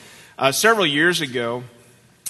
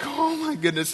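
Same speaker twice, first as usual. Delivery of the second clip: mortified exclamation; 13 semitones higher than usual. Retold or imitated. Retold embarrassed father's exclamation.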